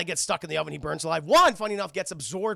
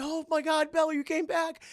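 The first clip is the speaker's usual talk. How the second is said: high-pitched, hammed-up astonished joy; mock elation; 11 semitones higher, 4 dB louder than usual. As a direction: gush at a loved one's return. Beast overjoyed to see Belle.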